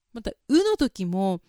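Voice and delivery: normal talking voice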